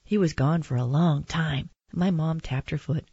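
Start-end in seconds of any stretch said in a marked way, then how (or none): none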